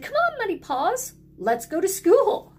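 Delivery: high, eager call to the dog; excited call. A narrator's reading. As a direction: call the dog along cheerfully.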